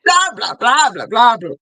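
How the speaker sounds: put-on dish the juiciest story to a girlfriend; juicy gossip. Shown as a demonstration. Coach showing how to say the script to a friend, gossipy. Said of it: "telling a friend the juiciest story".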